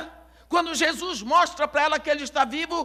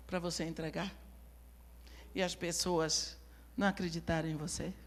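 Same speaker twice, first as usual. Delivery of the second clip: quiet; 6 semitones lower, 13 dB quieter than usual. Real, not acted. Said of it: "preacher turns intimate, quiet".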